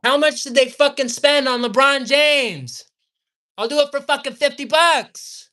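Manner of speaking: loud, high-pitched angry shouting; heated. Real, not acted. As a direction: yell it in real outrage. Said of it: "I'm getting heated".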